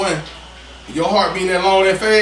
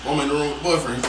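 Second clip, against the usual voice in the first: usual speaking voice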